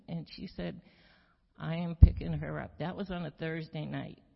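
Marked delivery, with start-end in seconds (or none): 1.6-2.8 firm loud declaration. Retold or imitated. Betty declares to husband.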